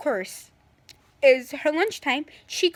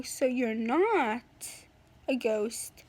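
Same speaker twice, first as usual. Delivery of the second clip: slow mock realization; 4 semitones lower, 4 dB quieter, much slower than usual. Acted sly reply to Keelan.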